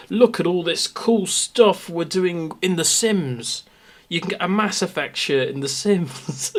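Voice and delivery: lightly mocking PR hype. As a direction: sell it like a cheesy ad. Mocking blog post.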